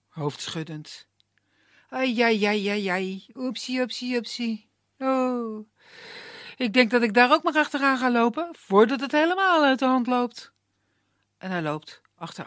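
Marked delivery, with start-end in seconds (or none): 1.9-10.5 flustered sprite voice, stammering; a sheepish sprite fumbling for words; Puck the forest sprite. A narrator's reading. Puck stammers sheepishly to Annemaria, a flustered sprite voice.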